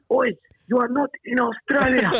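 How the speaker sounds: lightly acted heckler, calling out; retold heckler. Audience member corrects the comedian on stage, mock heckle.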